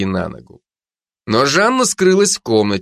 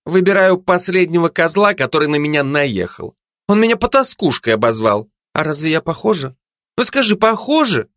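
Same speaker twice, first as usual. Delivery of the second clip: aggrieved Zhanna voice; 7 semitones higher than usual. Acted.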